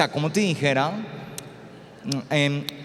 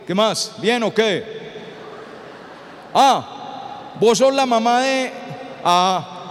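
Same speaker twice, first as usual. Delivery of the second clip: novio de dieciséis. Acted. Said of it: "Llega el novio de dieciséis".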